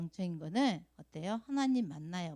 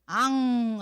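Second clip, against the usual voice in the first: loud mock wail; imitated child's cry; 3 semitones higher, 7 dB louder, much slower than usual. Teacher shows the children how Sua cries, mock wail. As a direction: burst out in pretend crying. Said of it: "하고 이게 우는 게".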